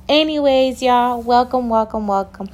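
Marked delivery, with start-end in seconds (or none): none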